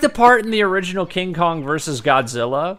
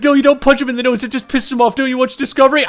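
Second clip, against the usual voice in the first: hurried mock scold; 7 semitones higher, much faster than usual. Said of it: "He's just like".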